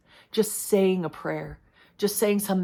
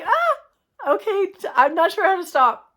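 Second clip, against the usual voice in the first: startled, much higher and louder; flustered surprise; 12 semitones higher, 6 dB louder than usual. Retold or imitated. Caught out by God, answering in high flustered surprise. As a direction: caught off guard, laughing at herself. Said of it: "I was like".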